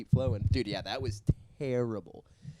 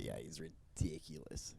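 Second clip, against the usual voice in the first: whispering; 6 semitones lower, 12 dB quieter than usual. Real, not acted. Whispered aside.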